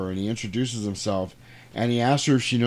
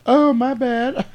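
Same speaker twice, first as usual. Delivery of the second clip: much higher, sheepish oops; high mock-embarrassed apology; 13 semitones higher, 6 dB louder, slower than usual. Frankie caught swearing by the priest, high sheepish oops.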